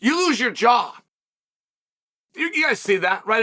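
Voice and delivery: ordinary delivery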